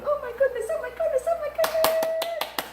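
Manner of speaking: high gushing coos, slowing at the end. Real, not acted. Owner coos at her beloved bird, high gushing delight. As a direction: squeal with adoration over the pet.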